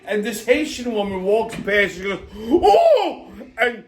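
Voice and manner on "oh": mock shriek of fright, much higher and louder; imitating a startled cry. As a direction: squeal like a passer-by caught off guard. Woman startled by skeleton.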